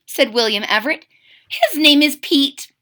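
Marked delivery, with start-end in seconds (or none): 1.5-2.7 put-on slow, high proud child voice; proud young crocodile. William proudly names his toothbrush to mama, high child. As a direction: show off the new friend.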